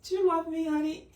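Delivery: a nervous lover fishing for reassurance; timid partner asking. Retold or imitated. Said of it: "And you just say".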